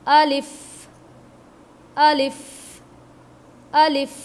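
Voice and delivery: अलिफ with a crisp, short ending; अलिफ said firmly, short at the end; clear letter demo. Shown as a demonstration. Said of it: "इसकी जो ending है". (अलिफ साफ़ और छोटा)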